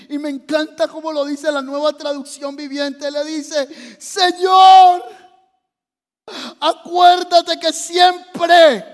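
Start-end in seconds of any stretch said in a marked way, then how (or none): 4.0-8.8 loud, high, drawn-out acted wail; crying out in prayer. Preacher voices Ezequías's prayer, anguished cry. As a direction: cry out to God, stretching every word. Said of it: "empezó a llorar y le dijo".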